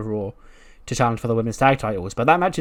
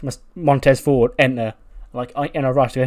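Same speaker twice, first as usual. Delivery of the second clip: ordinary delivery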